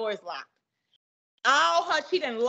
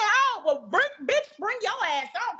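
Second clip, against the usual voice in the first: mock fight challenge; 8 semitones higher than usual. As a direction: egg her on to come fight. Retold dare to fight.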